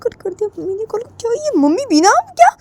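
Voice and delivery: high, frustrated complaint about mother; exasperated Jiya. Acted. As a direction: huff at the cut call, high. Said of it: "तब जिया बोलती है".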